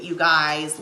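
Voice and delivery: usual speaking voice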